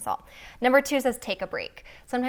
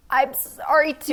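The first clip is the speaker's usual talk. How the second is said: strained apology; 6 semitones higher, 6 dB louder, slower than usual. Retold or imitated. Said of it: "so hard to be like".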